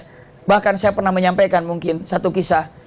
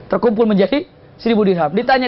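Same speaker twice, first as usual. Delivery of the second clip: ordinary talk, nothing marked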